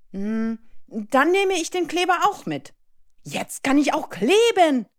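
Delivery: put-on high, lively little-boy voice; eager schoolboy. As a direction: chirp it like a keen first-grader. Narrator voices excited schoolboy Leo. (eifriger Schuljunge, hohe Stimme)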